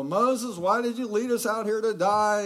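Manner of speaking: high, loud complaining crowd voice; the grumbling Israelites wailing at their leader; crying out. Retold or imitated. Israelites in the desert crying out to Moses, high and loud. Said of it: "they're crying out".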